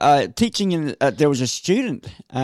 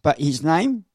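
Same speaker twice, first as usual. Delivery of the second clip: ordinary talk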